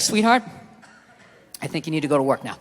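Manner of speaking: low, soft, controlled restraint; calm. Retold or imitated. Husband after being hit, re-enacted low calm. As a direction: say it evenly, keeping a lid on it. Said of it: "I just remained calm".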